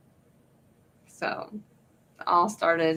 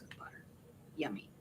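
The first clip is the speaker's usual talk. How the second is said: whisper; 23 dB quieter, faster than usual. Real, not acted. Dreamy food description.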